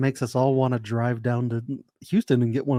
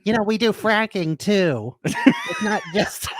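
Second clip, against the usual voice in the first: mock Texan reviewer; 9 semitones higher, slower than usual.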